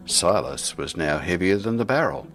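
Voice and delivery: ordinary delivery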